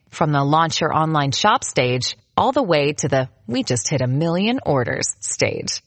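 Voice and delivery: ordinary delivery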